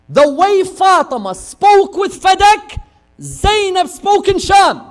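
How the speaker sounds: high, loud, slowed proclamation; preaching climax. Acted. Preacher building to Zainab's sermon, loud. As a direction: lift it up to the peak.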